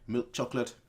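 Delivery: usual speaking voice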